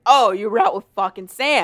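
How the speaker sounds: loud, bitter jab; jealous sarcasm. Retold or imitated. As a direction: throw it back jealously.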